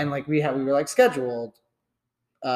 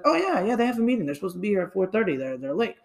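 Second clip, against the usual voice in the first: very high, fast secretary voice; nervous secretary imitation, pitched way up; flustered secretary; 6 semitones higher, faster than usual. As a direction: squeak it out all in a rush.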